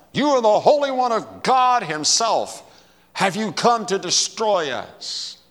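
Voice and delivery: loud, high cry of a challenged demon. Acted. Demonic spirit confronting Jesus in the synagogue, loud high cry. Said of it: "cried out".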